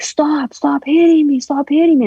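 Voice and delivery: mock scream begging someone to stop; imitated screaming. Mom begging him to stop hitting her, imitated scream. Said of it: "I heard my mom screaming".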